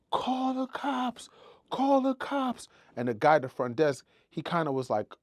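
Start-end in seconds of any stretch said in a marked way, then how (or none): 0.1-2.7 high, frantic yell for the cops; screaming for help. Retold or imitated. Robbed woman screaming at the sleepy security guard. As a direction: yell it at the front desk like your life depends on it. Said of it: "I just start screaming".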